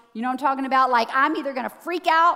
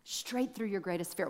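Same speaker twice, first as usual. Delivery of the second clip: softer, lower echo of the phrase; softly repeated; 6 semitones lower, 12 dB quieter than usual. Shown as a demonstration. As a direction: let it sink in quietly. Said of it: "The path to your greatest potential".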